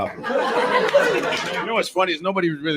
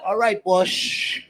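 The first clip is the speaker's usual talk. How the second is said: henchman; 8 semitones higher, much slower than usual. Pretend. Henchman agreeing in skit.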